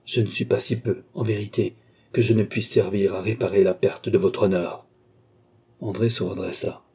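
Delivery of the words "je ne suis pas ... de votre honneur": ordinary delivery, nothing marked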